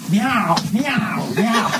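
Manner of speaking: high shouted meows; cat meows. Acted. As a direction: yowl like a caged cat. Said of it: "meow, right?".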